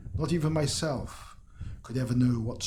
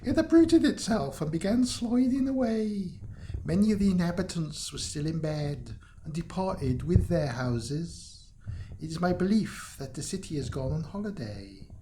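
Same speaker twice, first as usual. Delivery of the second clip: put-on a light, higher Italian accent for the local woman; 4 semitones higher than usual. Local woman tells the traveller Rome vanished, light Italian accent. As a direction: play her with a hint of an Italian accent. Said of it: "She should be Italian".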